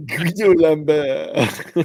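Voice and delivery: put-on slow, drawn-out French accent. Friend quoting the French knight, slow French accent. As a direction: stretch it out in mock French. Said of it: "only French accent I can do".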